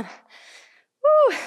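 high loud woo of effort; excited woo. Real, not acted. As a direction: whoop to push through the burn. Hyping through the final pulses.

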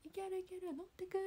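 高めで調子よく「いける、いける」; 軽いノリで押し切るように; 関西のノリ. Retold or imitated. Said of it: "みたいな、あの、なんかノリ". (高く弾むお調子者のノリ)

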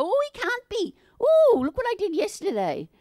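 high, whiny voice of self-doubt; mock the nagging inner voice; a high fretful inner critic. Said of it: "that little voice in your head go".

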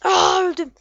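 loud, high cry of defeat; dying cry. Real, not acted. Player shot dead in the game, loud dismayed cry. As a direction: yell as you get killed.

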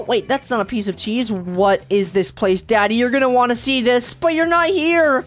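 normal talking voice

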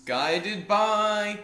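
slow grand prophecy voice; solemn goddess voice. Acted. Voicing the goddess to the player, slow solemn intoning. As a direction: intone it slowly like an oracle. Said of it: "I don't know why I'm talking like that".

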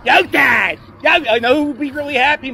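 loud shout across to Dad; excited shout to his father; calling out. Real, not acted. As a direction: yell it so he hears.

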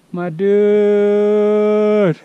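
awed exclamation. Real, not acted. Awed at summit view.

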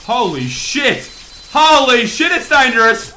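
loud, high, laughing alarm; shocked exclaim. Real, not acted. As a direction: gasp it out in disbelief. Shocked by dangerous level.

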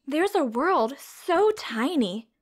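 bright, sing-song storybook read-aloud; reading to children. A narrator's reading. Teacher reading a science picture book to children, storybook voice.

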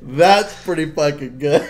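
slow laughing praise. Retold or imitated. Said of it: "I was like".

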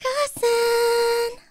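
high squealing cheer; excited cheer. Real, not acted. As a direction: cheer it out gleefully.

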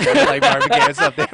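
high, loud and fast through laughter; laughing. Real, not acted. Joking he sounds like [name] next to his friend, laughing. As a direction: crack up mid-line.

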